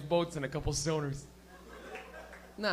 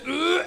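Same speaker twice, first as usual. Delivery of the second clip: loud strained gagging ugh; hammed-up retch; mock puke strain; 8 semitones higher, 7 dB louder than usual. Drunk person puking, mock strained retch. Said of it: "puking is this".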